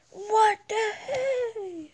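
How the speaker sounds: high, slow, drawn-out disbelief; startled cry. Real, not acted. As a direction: stretch it out in shock. Startled by game event.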